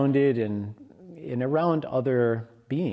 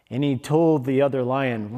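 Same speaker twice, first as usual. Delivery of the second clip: ordinary delivery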